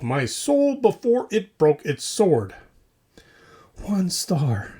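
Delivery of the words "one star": breathy mock-solemn whisper. Host reading the cursed review, whispered. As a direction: hush it like a secret.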